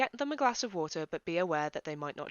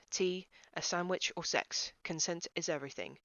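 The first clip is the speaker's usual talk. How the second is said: usual speaking voice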